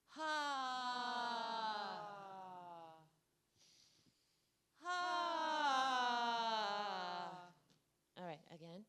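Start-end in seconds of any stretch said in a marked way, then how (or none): 0.1-7.3 long, sustained haaa on one breath; inhale, then let out a drawn-out ha; long exhaled ha. Shown as a demonstration. Voice exercise after inhaling, long open ha.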